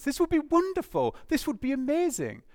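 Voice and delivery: high-pitched imagined delight; overjoyed. Acted. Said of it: "you'd be overjoyed at this".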